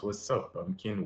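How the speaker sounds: ordinary talk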